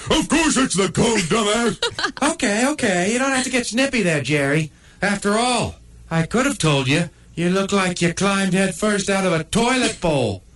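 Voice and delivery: the pug snipes back, high and quick; high snippy pug. Acted. Pug Buzz trades insults with the coned dog, high and snippy.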